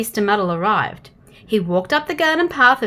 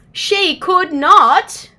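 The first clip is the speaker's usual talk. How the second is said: slow, high and loud; slow emphatic denial; 7 semitones higher, 5 dB louder, much slower than usual. A narrator's reading. Narrator telling listeners the punchline, slow and emphatic. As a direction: drag out each word for the punchline.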